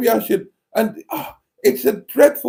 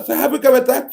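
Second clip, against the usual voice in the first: pitched way up, teasing; high mock voice; 10 semitones higher, much faster than usual. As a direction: squeak it out mockingly.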